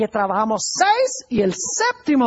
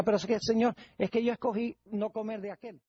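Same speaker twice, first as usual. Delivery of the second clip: much softer, sheepish plea to God; meek excuse; 11 dB quieter than usual. Retold or imitated. Man excusing himself before God, soft sheepish mumble. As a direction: mumble the lame excuse, barely audible. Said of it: "que el hombre dijera".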